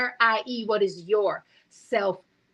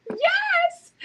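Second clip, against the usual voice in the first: a sudden, much higher squeal of joy; excited yes; 3 dB louder than usual. Retold or imitated. Retelling the dream to viewers, a high excited squeal. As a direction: relive the thrill of the dream out loud. Joyful exclamation in dream story.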